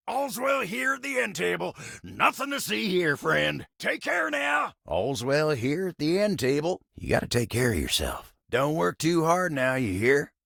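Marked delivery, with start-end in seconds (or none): 0.1-4.7 high-pitched, insistent reassurance; pitched-up cover-up; insistent brush-off. Acted. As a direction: wave them along in a high voice. Hurriedly fending someone off at table.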